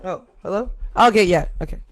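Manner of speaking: ordinary delivery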